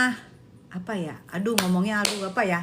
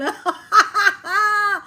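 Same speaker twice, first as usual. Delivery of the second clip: laugh-cry burst; 5 semitones higher than usual. Real, not acted.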